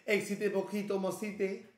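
gibberish in a put-on Spanish accent; mock Spanish. Reactor teasing J I D for learning Spanish, in mock Spanish. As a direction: fake your way through Spanish, playfully. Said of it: "J I D learning Spanish now".

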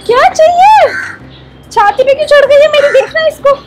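high, sing-song voice for the dog; squeaky pet talk. Real, not acted. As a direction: coo at the dog climbing on you. Dog climbing on her chest.

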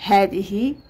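ordinary talk, nothing marked

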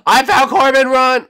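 high, loud scream; sudden squealed shout; excited alarm. Real, not acted. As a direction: shriek the discovery and warning. Spots hidden player.

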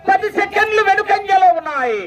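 high sustained call, falling at the end; high announcer call. Real, not acted. Announcer telling crowd the time gap, high ringing call. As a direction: call it out high, drop on the last word. Result called high, then repeated lower.

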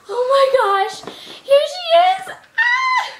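rising into a high squeal; giddy reveal, climbing to a shriek; thrilled. Real, not acted. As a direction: present her with mounting glee. Doll is revealed to camera.

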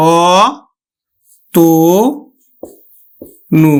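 অ তো নু, the ত said like তো; syllable by syllable. Shown as a demonstration. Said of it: "আমরা উচ্চারণ করবো কিন্তু". (অতনু ভেঙে অ-তো-নু)

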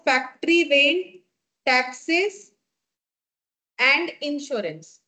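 item by item with long pauses; slow dictation. Real, not acted. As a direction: dictate it piece by piece for writing down. Dictates item after "write.

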